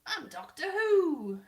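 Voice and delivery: high, loud, stretched-out announcement; hammed-up slow swoop up on the name; mock Jodie Whittaker. Vlogger in a wig playing Doctor Who, hammed-up. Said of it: "my Jodie Whittaker wig".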